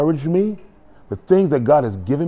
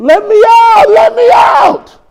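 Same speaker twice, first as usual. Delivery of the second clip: high, loud desperate scream; drawn-out pleading shriek; acted screaming; 9 dB louder, slower than usual. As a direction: scream it like someone trapped in a cage. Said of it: "who was screaming".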